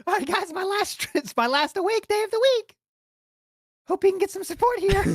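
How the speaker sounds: very high squeaky pet voice; begging virtual-pet streamer. Pretend. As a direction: beg for tips in a tiny high voice. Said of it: "imagine if he was like".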